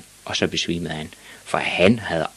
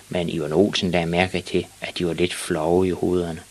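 plain reading voice

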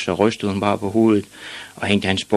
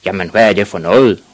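loud, outraged father; acted angry outburst; shouting; 7 dB louder than usual. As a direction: thunder it like an exasperated dad. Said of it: "råbte hans far og slog i bordet".

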